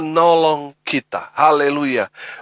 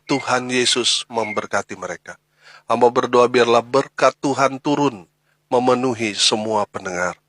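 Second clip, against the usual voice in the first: prayer; 4 semitones lower than usual. Acted. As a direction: pray gently over the listeners. Pastor leads a closing prayer.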